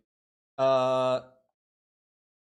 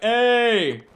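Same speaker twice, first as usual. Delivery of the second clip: higher, louder mock call to a stranger; retold shout over voice chat; yelling; 7 semitones higher, 7 dB louder than usual. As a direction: call out to get a random player's attention. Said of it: "I would be yelling their name".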